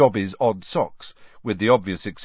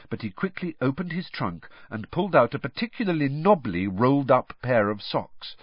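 ordinary delivery, nothing marked

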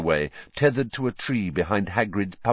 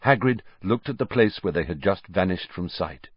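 ordinary delivery

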